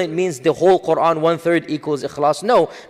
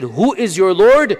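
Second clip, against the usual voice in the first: higher, louder and slow; demanding question, drawn out; challenging question; 5 semitones higher, 5 dB louder, slower than usual. Retold or imitated. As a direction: ask it as a challenge. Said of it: "when they said".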